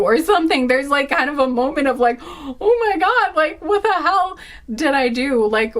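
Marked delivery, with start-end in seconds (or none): 2.2-5.5 mock horror. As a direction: play the stunned regret lightly. Imagined couple's horrified reaction.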